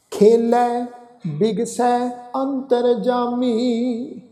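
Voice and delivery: slow recitation with long held final syllables; measured level-toned sacred reading; scripture recitation. Acted. Teacher recites Gurbani to the congregation, slow scripture recitation.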